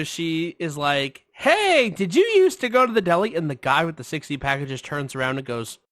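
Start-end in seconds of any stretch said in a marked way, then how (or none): 1.3-3.3 high, loud mock question; imitating nosy stranger. Woman to package man, high loud imitation. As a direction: hail him in her high voice.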